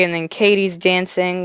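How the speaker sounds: ordinary talk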